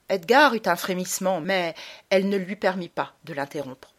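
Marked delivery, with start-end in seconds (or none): none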